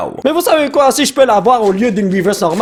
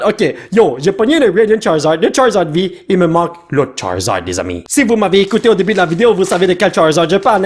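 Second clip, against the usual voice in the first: usual speaking voice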